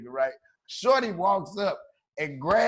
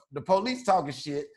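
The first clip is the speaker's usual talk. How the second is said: normal talking voice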